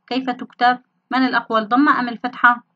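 ordinary talk, nothing marked

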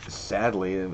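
normal talking voice

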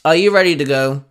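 brusque and to the point; blunt question. A narrator's reading. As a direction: ask bluntly, no pleasantries. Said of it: "Albedo asked bluntly".

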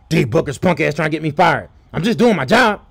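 usual speaking voice

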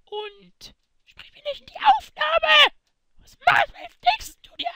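loud, high, slow scolding yell; angry yell. Acted. Milchbubi yells at Gomme for talking into the recording, loud and high. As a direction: bite his head off for butting in.

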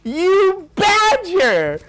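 high, loud, slow dramatic yell; hammed-up outrage, drawn out; mock outraged yell. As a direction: bellow the verdict like a scandalized friend. Friend yelling Hufflepuff verdict.

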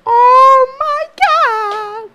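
very high, loud, stretched-out exclamation; long squealed shout; shocked cry. Real, not acted. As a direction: wail it out slowly and loudly. Recalling the scare, exclaiming.

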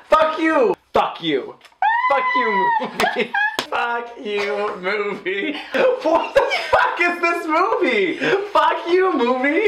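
high, exasperated mock outburst; mock rant. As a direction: rail at the screen in mock fury.

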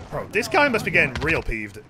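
ordinary talk

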